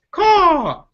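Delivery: high, loud crow call; sharp bird caw. Acted. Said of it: "that's the crow".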